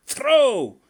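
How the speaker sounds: high, loud yelled command; shout. A narrator's reading. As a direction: bellow the one word across the river. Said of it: "until you hear me shout".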